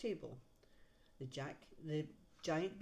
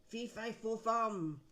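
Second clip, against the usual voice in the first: mild giant shout; 4 semitones higher, 4 dB louder, slower than usual. A narrator's reading.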